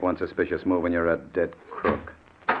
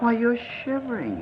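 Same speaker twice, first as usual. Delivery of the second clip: much higher mock sympathy; mock concern. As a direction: fake pity, sneering. Kidnapper taunts trembling victim.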